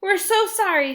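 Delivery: high, loud, scared apology; frightened apology. Acted. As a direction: apologize in a panic. Alex frightened by troll.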